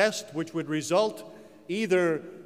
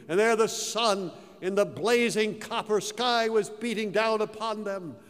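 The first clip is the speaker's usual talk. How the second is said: usual speaking voice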